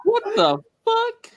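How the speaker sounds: high, squeaky outburst; mock shocked cry; high yelled swear. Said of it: "I just said".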